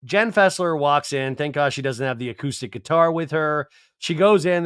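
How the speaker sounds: usual speaking voice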